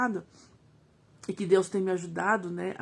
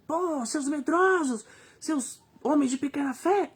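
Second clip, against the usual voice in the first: higher, slow scornful scolding; mock scolding; 5 semitones higher, slower than usual. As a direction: scold the frightened disciples slowly, with scorn. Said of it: "Eu não vejo Jesus falando nesse tom".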